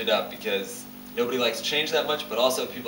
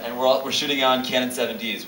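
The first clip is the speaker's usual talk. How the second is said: ordinary delivery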